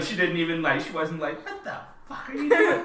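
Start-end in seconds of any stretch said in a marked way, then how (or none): 1.5-2.8 high-pitched mock outrage; hammed-up shocked protest. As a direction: squeal it in put-on disbelief. Acting outrage the character didn't show.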